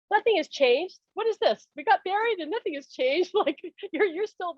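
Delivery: high-pitched mock complaint; retold renewed anger. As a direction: act out indignant protest with a laugh breaking in. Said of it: "I got angry again".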